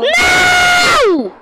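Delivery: high, loud shout of no. Real, not acted. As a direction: scream it out in shock. Reacting to challenge.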